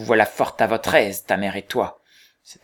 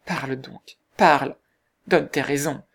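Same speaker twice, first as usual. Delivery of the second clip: rising, louder demand; urgent cry; 3 semitones higher, 4 dB louder than usual. A narrator's reading. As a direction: push him to speak, pressing hard. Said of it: "s'écria-t-elle".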